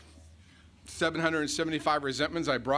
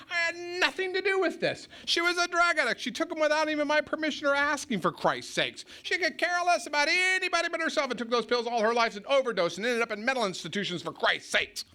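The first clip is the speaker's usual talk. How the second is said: higher, fast-building retold rant; mock-furious outburst in a much higher voice; indignant rant; 11 semitones higher than usual. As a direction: rail at him like a wronged teenager. Retold rant defending himself.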